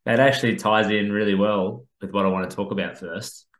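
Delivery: ordinary delivery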